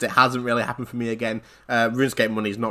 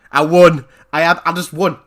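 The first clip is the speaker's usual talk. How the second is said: loud, raised burst of triumph; elated; 4 semitones higher, 8 dB louder than usual. Retold or imitated. Player telling how his all-in stake paid off, elated. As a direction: let the win burst out.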